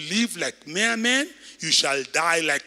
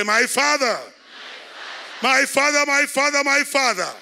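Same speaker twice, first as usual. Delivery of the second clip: higher and louder, My Father called out over and over; loud calling out; 7 semitones higher than usual. Shown as a demonstration. Preacher leading the church to call on God, loud. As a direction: cry My Father out to heaven.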